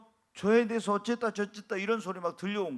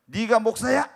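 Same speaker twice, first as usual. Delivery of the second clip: retold sharp rebuke; 3 semitones higher, 9 dB louder than usual. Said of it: "여기서는 좋게 말해".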